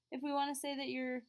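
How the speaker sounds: normal talking voice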